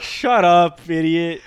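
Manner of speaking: loud, hammed-up brush-off; mock dismissive shout. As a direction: shout down the warning in play. Acted brush-off of the warning.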